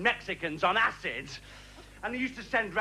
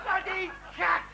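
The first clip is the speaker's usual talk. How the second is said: higher, shouting cack cack; ranting refrain; 8 semitones higher, slower than usual. Acted. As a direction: bark it in disgust.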